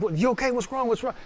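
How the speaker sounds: high, soft worried questions; imitated concerned wife. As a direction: voice an anxious wife checking on her husband, softly. Acts wife asking as he cries.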